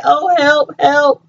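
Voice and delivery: lightly mocking help cry; mocking fake plea. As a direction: mimic his joking help call. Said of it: "making light of the situation".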